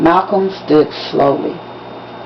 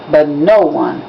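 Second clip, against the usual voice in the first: ordinary delivery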